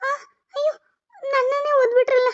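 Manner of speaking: high wailing cry, hurt and shocked. Acted. Wife cries out after being kicked by husband, wailing. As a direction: wail as if just kicked.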